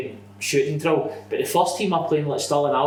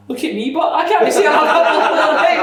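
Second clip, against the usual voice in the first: high, loud incredulous objection; high-pitched comic complaint, half laughing; mock protest; 12 semitones higher, 7 dB louder than usual. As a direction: wail it as a hammed-up protest. Acted protest from friend in story.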